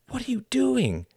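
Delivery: high-pitched alarmed demand; upset cry. A narrator's reading. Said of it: "cried Tom".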